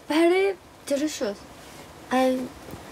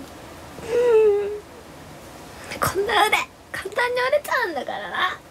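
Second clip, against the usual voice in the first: high, teary whimpering voice; mock crying; 6 semitones higher, slower than usual. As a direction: whine it out like a fake cry. Repeated line, crying take. (高い声で泣きまねをして)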